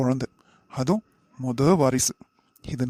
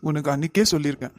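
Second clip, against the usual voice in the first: ordinary talk, nothing marked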